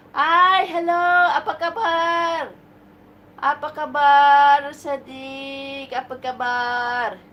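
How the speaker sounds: high, loud, drawn-out hello; calling out a greeting. Real, not acted. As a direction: greet a faraway viewer loudly and high. Greeting an Indonesian viewer on live. (mataas at malakas na pagbati)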